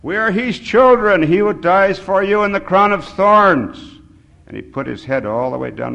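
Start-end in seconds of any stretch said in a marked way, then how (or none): 0.0-4.0 high, loud, slowed proclamation; sobbing plea. A narrator's reading. Sobbing old man invokes Christ to the men, wailing it. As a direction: preach it as an anguished cry. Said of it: "He's sobbing".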